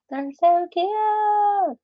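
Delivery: high, slow, gushing over something adorable; delighted coo. Real, not acted. Admiring ladybug charms for Debs, a high slow gushing coo. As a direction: melt over how cute it is.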